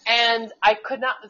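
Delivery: usual speaking voice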